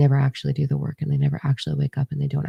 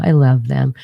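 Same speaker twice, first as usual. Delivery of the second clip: ordinary talk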